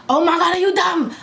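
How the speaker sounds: outraged. Retold or imitated. Spat on by a stranger, high outraged disbelief. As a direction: let the outrage burst out. Outrage after being spit on.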